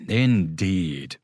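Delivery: ordinary delivery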